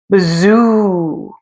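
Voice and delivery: long eerie wind whoosh; howling wind sound. A narrator's reading. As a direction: blow it out like a moaning wind. Wind sound effect in story.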